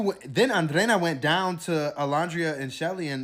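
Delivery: ordinary delivery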